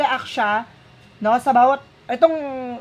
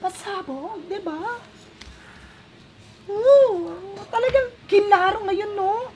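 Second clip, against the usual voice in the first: much higher, slow gasping amazement; high-pitched shocked excitement; shocked and excited; 11 semitones higher, slower than usual. Real, not acted. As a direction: react like the card just betrayed you. Amazed at the reading.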